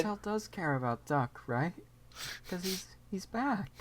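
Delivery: hushed, soft and earnest; softly reassure, almost a whisper. Pretend. Hushed echo of the line.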